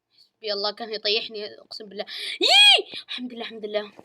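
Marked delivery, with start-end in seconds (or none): 2.4-2.9 sharp high squeal; high shriek. Real, not acted. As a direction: let out a sudden shriek. Shriek in game.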